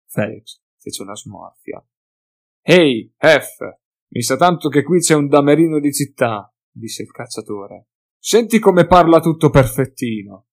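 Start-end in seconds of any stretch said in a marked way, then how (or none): 2.6-6.5 louder, jeering brute; taunting hunter. Acted. Drunk hunter taunts Felix. 8.2-10.5 higher, louder sneer in a coarse voice; mock his fancy speech; mocking hunter. Hunter mocks Felix's speech.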